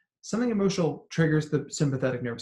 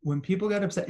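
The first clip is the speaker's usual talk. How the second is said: usual speaking voice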